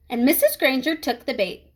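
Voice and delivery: plain reading voice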